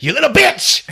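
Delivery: loud, high angry outburst; angry curse. Acted. Bitten bum curses Jennifer in a loud high outburst. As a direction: spit it out after being bitten.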